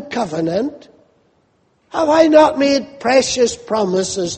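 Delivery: high, mildly insulted questioning; offended God. Acted. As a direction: press the questions as if wronged. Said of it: "as though God is offended and God is insulted".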